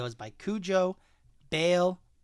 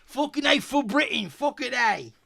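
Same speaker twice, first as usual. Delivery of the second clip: high, hammed-up British accent; mock-patriotic British cry; 7 semitones higher than usual. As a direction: play up a silly British accent, pitched up. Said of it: "Then you could bring in a British accent".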